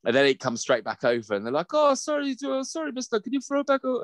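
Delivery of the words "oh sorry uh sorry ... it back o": put-on high, apologetic Irish kid voice; Irish children. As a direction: plead like a little Irish child. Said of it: "they've got beautiful little Irish accents".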